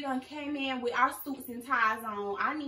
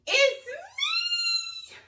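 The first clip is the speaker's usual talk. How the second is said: high shrieking cry of joy; excited scream; 10 dB louder than usual. Real, not acted. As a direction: scream with delight at seeing yourself. Seeing herself walk on stream.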